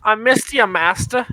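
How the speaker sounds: playful squeaky welcome; mock servant greeting. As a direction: greet him like a fawning servant. Teasing greeting to returning friend.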